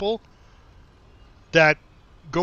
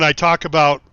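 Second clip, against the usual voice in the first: ordinary delivery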